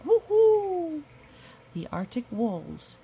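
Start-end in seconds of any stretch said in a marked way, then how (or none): 0.1-1.0 very high, loud owl screech. Acted. Owl swooping down in the story, a loud high screech. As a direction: let the great gray owl screech it out.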